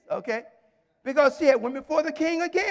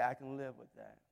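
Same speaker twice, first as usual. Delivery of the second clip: low, hushed words with long pauses; halting, very soft appeal to the king; quiet plea; 13 semitones lower, 17 dB quieter than usual. Acted. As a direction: let each word fall softly, with gaps. Esther pleading with the king.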